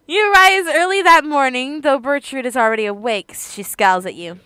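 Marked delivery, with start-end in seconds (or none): none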